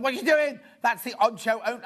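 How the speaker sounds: mock outrage. Presenter retelling scolding a friend over a tea towel, loud. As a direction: protest loudly that the towel is for show. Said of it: "I was like".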